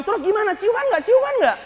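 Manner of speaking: high, eager squealing questions; excited fangirl. Acted. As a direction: beg for the spoiler. Forum fan asking excitedly. (nada tinggi penuh semangat)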